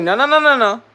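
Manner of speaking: high, loud string of refusals; emphatic refusal. Retold or imitated. Worker told to resign, loudly protesting. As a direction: cut the suggestion off, pitch jumping up.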